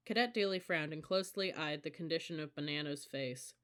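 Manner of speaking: ordinary delivery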